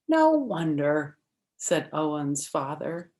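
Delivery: bright, lively storybook read-aloud; reading to children. Acted. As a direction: read it like story time for little kids. Reads picture book to children.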